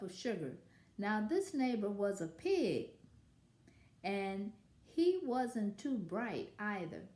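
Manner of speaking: lively read-aloud as the wolf telling his side; story narration. A narrator's reading. Wolf narrator telling children his side, storytelling voice. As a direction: read it like a sly storyteller to kids. Reads picture book as wolf.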